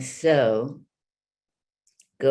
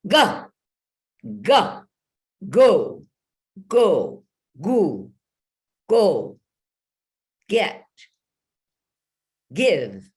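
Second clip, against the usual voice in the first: "Ga" higher and much louder, full power; strong ga; 5 semitones higher, 6 dB louder, slower than usual. Shown as a demonstration. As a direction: blast each "ga" out with force. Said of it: "Really strongly".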